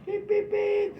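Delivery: high, loud beeps; squeaky honking noises, very high; imitated car honks. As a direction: mimic car horns tooting. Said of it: "people were honking me".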